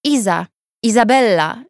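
usual speaking voice

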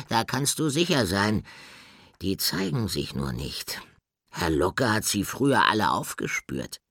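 a small bird talking big about thieves; lightly voiced confident bird; lightly voiced Horn. Horn's voice in story.

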